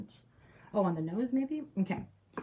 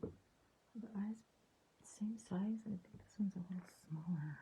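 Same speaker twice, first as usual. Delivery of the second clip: soft whisper, fully concentrating; softer whispered working notes; 8 dB quieter than usual. Real, not acted. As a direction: barely breathe it while painting the eyes. Concentrating on painting eyes.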